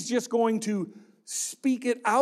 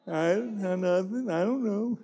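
put-on guilty kid. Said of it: "we'd say".

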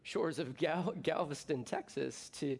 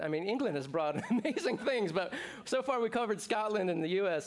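laughing; 5 semitones higher, 3 dB louder than usual. Real, not acted. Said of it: "laugh heard on lines".

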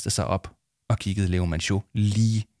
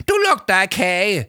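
whining; 9 semitones higher, 4 dB louder than usual. Acted. Dangling adviser whining.

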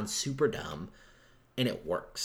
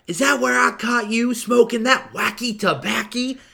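higher, louder, slow twangy character voice; Bill Roberts impression; 11 semitones higher, 10 dB louder, slower than usual. Quoting Bill Roberts teasing about the attic, slow high twang. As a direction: ham up the odd twang of the cop character. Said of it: "Dan Hicks as Bill Roberts".

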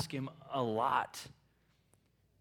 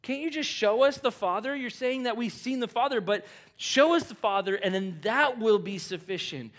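pleading Philip; 7 semitones higher, 4 dB louder than usual. Retold or imitated. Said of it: "Philip's like".

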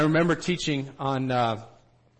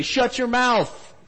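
imitated angry heckling; 8 semitones higher, 5 dB louder than usual. Listener who dislikes the teaching stands up, imitated angry shout. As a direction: shout them down like an offended listener. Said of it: "they'll also stand up and say".

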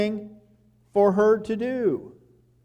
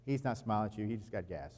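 much softer, low, offhand mother's remark; 2 soft mom aside; 8 semitones lower, 13 dB quieter, faster than usual. Retold or imitated. Mom teasing dad about baby's smile, said softly and low. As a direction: quietly burst dad's bubble. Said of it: "the mom will say".

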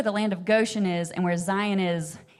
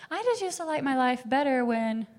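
self-pitying whine; 6 semitones higher than usual. Retold or imitated. Imitating her past self.

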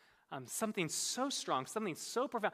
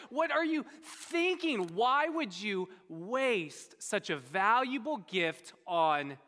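acted scolding, drawn out word by word; indignant, talking down; slower than usual. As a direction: talk down to her, incredulous and slow. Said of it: "They talked down to her".

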